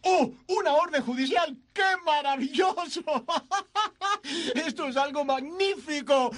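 high, shouted joy with a laugh; giddy delight. Acted. George overjoyed even at the warrant, shouting and laughing. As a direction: greet bad news as wonderful.

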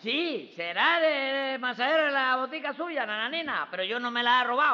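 protesting, voice pushed up; shouting in self-defence. Acted. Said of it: "no grite".

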